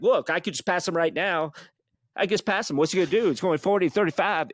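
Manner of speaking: a cocky, hammered driver insisting; imitating Jay. Drunk friend insisting he could pass, mock bravado. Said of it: "He's like".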